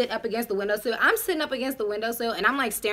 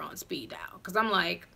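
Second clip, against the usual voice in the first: hushed, breathy under her breath; whispered aside; 4 semitones lower, 7 dB quieter than usual. Retold or imitated. Whispering to the audience about her secret escape plan.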